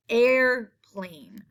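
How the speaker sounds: "air... plane", first half held, then a falling "plane"; syllable by syllable. Shown as a demonstration. Teacher pointing out the long A, "airplane" split in two. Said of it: "hear A in the first half".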